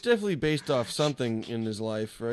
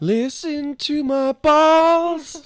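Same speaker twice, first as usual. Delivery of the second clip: squeaky, drawn-out goofy voice; silly high dub voice; high slow silly voice; 8 dB louder, much slower than usual. Acted. Dubbing video, silly voice.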